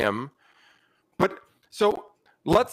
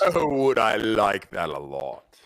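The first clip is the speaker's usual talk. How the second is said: slow, low, relishing reply; hammed-up low, lingering delight; low character voice; 8 semitones lower, slower than usual. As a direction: drop deep and savor it slowly. In-character reply to healing offer.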